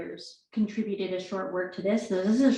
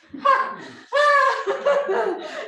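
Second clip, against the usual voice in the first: a high sobbing wail, louder; acted sobbing wail; 4 dB louder than usual. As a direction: let a high wail break out. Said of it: "more crying".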